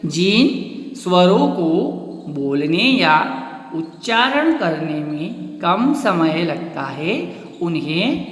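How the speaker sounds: slow, every word spaced out; slow dictation. Shown as a demonstration. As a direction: dictate it slowly for notes. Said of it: "क्या परिभाषा बन गई आपकी?". (धीमे बोलकर लिखवाना)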